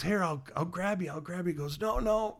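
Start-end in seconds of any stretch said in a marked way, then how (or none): 0.0-1.5 urgent rescuer. Acted. Rescuer reaching for drowning man. 1.8-2.4 higher, strained refusal as if going under; gurgling drowning man. Acted. Drowning man waves off the boat, acted gurgling.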